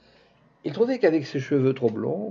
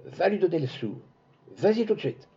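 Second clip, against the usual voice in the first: a touch of anger, ending higher; angry command; 6 semitones higher than usual. Acted. Little girl ordering the maid to give the coin, mild anger. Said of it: "d'une voix que la colère enrouait".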